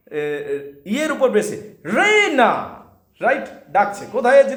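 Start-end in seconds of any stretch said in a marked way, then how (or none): 1.8-2.7 Reyna hit on রে, high and loud; stress on রে. Shown as a demonstration. Teacher models the stress on Reyna. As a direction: punch রে in Reyna. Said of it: "With the stress on রে".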